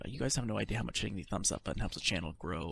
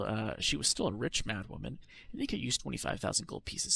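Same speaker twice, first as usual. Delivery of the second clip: normal talking voice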